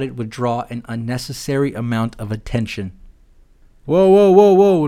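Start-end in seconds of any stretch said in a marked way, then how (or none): 3.9-4.9 mock alarm. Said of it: "mock politician distracting".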